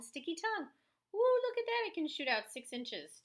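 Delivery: delighted gasp at a frog's long tongue; excited. Real, not acted. Excited teacher shows the picture.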